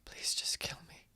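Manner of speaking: hushed, breath-only begging; very soft, resigned plea; whispered desperate plea. Acted. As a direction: whisper it to your captor as a last request. Captive begs to be killed.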